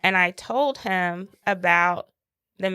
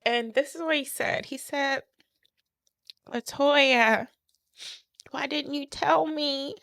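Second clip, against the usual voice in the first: tearful; 6 semitones higher than usual. Real, not acted. Tearful retelling after crying.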